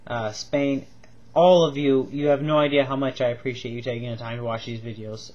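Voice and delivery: ordinary delivery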